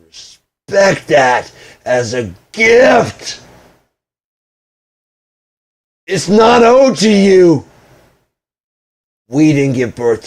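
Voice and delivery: high, very loud, drawn-out yell; angry shouting. Real, not acted. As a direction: shout it slowly as a furious rebuke. Angry rant at partner.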